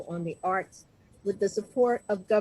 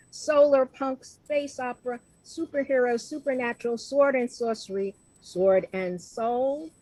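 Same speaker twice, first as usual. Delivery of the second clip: normal talking voice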